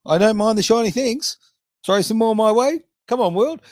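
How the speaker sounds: high, loud mock greedy plea; playful greed.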